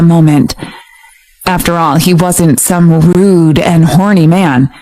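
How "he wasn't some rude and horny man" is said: ordinary delivery, nothing marked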